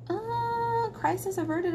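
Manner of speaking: high, unsure crisis averted; doubtful question. Real, not acted.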